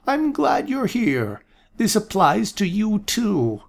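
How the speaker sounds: put-on high, slow, pleased old man; an absent-minded prophet welcoming guests; old seer voice. A narrator's reading. Aaron greeting Amanda, high slow old voice.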